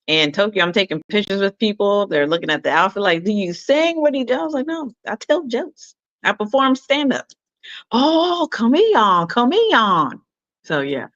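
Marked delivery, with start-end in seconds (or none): 3.2-7.2 higher, lively retelling; retold chat, raised pitch. Retold exchange with fans. 7.9-10.2 high, delighted coaxing; excited fans. Retold or imitated. Fans' excited reply.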